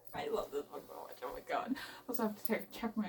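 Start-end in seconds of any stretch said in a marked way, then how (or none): 0.1-1.8 a fast breathy whisper of "I love this book so much", much softer and lower; whispered gush. Real, not acted. Reader murmurs to herself over the book in a quick whisper. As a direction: breathe it out to herself, barely voiced. Murmured aside while reading.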